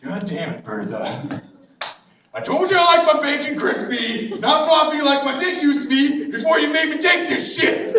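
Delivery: angry husband. Acted. The husband to Bertha, angry over floppy bacon. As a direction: bark at her over the bacon. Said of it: "he's like".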